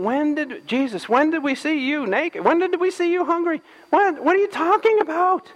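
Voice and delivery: baffled people asking when; puzzled protest. Pretend. The righteous protesting to Jesus.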